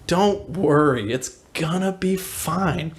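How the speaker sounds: slow, drawn-out, unbothered; laid-back reassurance. Retold or imitated. Friend on the Jeep calms panicking friends, laid-back. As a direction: stretch it out like nothing matters.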